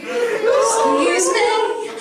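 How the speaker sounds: high, long drawn-out exclamation; mock Buc-ee's character. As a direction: stretch it out high in a comic character voice.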